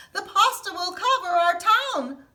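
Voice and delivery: high, loud frightened cry; fearful cry. Acted. Townspeople fleeing the pasta, loud frightened cry. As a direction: cry out in panic. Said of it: "they cried".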